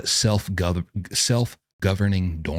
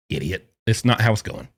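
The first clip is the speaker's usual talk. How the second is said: usual speaking voice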